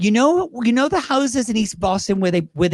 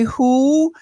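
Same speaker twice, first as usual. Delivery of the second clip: a clueless [name] asking who, pitched higher; [name] impression; 6 semitones higher, 3 dB louder than usual. Telling the interviewer about his [name] bit, blank [name] "Who?". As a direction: play [name], who never knows anyone. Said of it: "that was my version of [name]".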